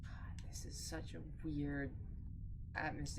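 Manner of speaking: hushed, slow whisper. Real, not acted. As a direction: breathe it out, spooked. Alone in creepy house.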